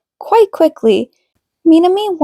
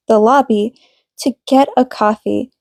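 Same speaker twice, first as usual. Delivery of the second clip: ordinary delivery, nothing marked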